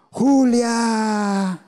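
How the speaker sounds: long hoarse cry. Acted. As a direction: cry out the name across the lake. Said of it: "en un grito largo y ronco".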